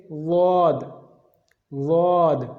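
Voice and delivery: واد said heavy, tongue side to molars; ضاد sound drilled. Shown as a demonstration. Teacher drilling the ضاد sound for learners. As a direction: sound out واد deliberately twice. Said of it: "تو آپ ادا کریں گے".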